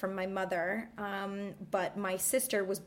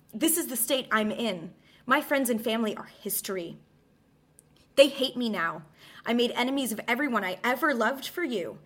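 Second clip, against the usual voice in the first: scornful, raised theatrical monologue; Medea's bitter speech; 3 semitones higher, 4 dB louder than usual. Acted. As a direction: hurl it at Jason like a betrayed queen. Dramatic Medea monologue read.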